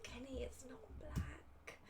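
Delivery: slow, soft whisper, just breath; hushed warning. Retold or imitated. Whispering to Kenny that his armor is the wrong colour. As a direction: whisper it like a secret you must not let slip.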